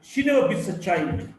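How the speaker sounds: put-on weight on the last word, "child"; "child" stressed, as opposed to her husband. Shown as a demonstration. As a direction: save the punch for CHILD. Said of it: "Maybe she beats her husband".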